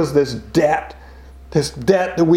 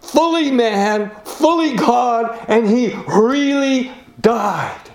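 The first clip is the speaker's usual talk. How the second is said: raised, slowing sermon proclamation; preaching; 7 semitones higher than usual. Acted. Preacher proclaiming the cross to the congregation, rising fervour. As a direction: build it up like a preacher at the pulpit.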